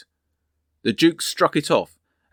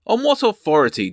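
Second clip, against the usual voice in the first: higher, louder haughty demand; a duke challenging the watchman; 8 semitones higher, 5 dB louder than usual. A narrator's reading. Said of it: "demanded Monmouth".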